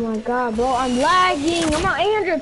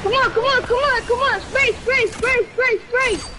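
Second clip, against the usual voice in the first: excited urging; 7 semitones higher than usual. Real, not acted. Urging teammates to shoot.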